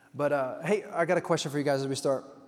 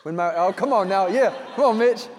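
louder and higher, delighted call-out; excited reaction; 5 semitones higher, 9 dB louder than usual. Real, not acted. Pastor cheers a congregant's wife-cooking answer, excited and loud.

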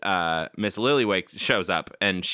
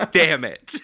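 laughing; 12 semitones higher, 4 dB louder, much slower than usual. Pretend. Laughing, playing caught criminal.

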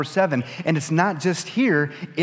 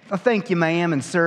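polite Southern reply; 5 semitones higher than usual. Retold or imitated. His own polite reply.

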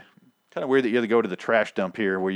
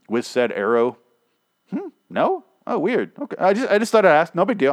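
high, loud, fake-casual probing of the crossbow guy; mock innocent accusation; 3 semitones higher, 4 dB louder than usual. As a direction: pretend you suspect nothing while pointing fingers. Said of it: "went exactly like that, word for word".

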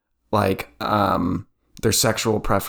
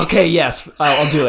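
higher, louder, rushed mock acceptance; mock flustered yes; 4 semitones higher, 4 dB louder than usual. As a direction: blurt it out like a panicked bride saying yes. Mock bride accepting proposal, flustered.

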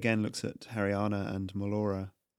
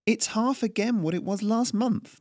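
higher, sharp retort; snapped; 11 semitones higher than usual. A narrator's reading. Baker Melora snaps at the tax collector, indignant. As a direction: bite back at him. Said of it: "Melora snapped".

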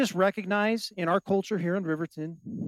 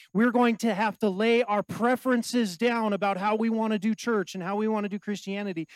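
usual speaking voice

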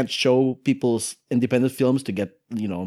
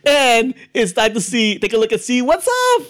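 higher, louder put-on Indian accent; 10 semitones higher, 4 dB louder than usual. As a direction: keep the Indian accent going. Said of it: "doing an Indian accent".

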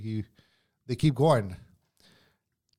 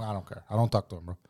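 usual speaking voice

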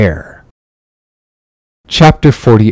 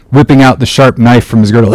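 plain reading voice

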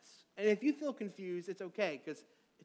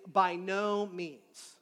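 stretched slowly, every word weighted; emphatic refusal; much slower than usual. Shown as a demonstration. Preacher explaining Paul's phrase, slow and emphatic. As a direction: drag it out like a firm verdict. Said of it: "a very emphatic way of saying no".

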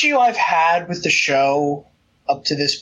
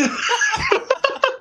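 high laugh. Real, not acted. After the trailer bit.

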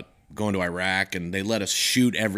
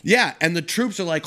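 ordinary talk, nothing marked

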